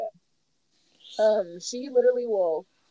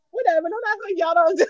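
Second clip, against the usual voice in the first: sassy retort; 9 semitones higher, 7 dB louder, faster than usual. Retold or imitated. Retort to judging onlookers.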